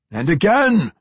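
loud, high booming demand; demanding dwarf. A narrator's reading. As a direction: demand it like a straining giant. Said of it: "the dwarf demanded".

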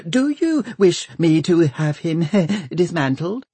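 high, chattering doctor; fluttery high voice with a little giggle; twittering. A narrator's reading. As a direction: prattle eagerly about a pet project. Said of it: "twitters the doctor".

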